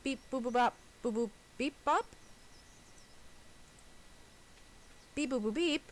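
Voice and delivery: sing-song beeping robot; toy robot voice. Pretend. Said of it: "You sound less like a robot now".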